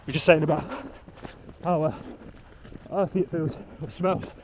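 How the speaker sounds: halting, breathless while running; gasping between words mid-run; out of breath. Real, not acted. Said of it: "Can't even speak when you're running".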